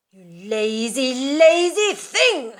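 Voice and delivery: loud, high, slowed furious yell; furious cry. Acted. Mother yelling at her lazy daughter, furious. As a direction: spit the words out at her. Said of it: "she cried".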